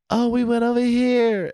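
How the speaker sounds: put-on high, breathy girl voice; girly voice impression. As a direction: mimic a narrator doing the girls. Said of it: "when he sounds like the girls".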